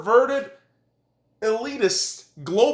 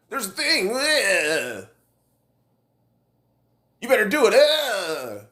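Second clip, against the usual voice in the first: mocking promoters. Ranter mimics people pushing the vaccine threshold, mock wailing. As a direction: imitate fretful pleading, breaking into silly long cries.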